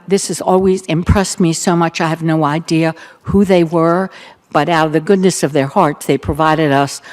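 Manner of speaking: usual speaking voice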